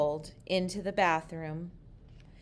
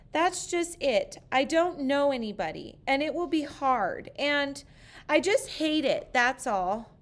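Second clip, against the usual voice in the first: put-on higher, louder childish whine; upset child; 8 semitones higher, 4 dB louder than usual. A narrator's reading. Sarah telling her father her fears, whiny. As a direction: rush the worry out like a fretful kid.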